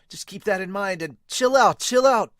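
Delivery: mock calming. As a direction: push the teammate to calm down. Teammate calming someone.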